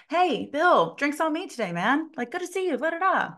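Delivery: high, hail-fellow hello to a regular; cheery greeting. Retold or imitated. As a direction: act out greeting the guys at the bar. Acts out greeting the bar guys.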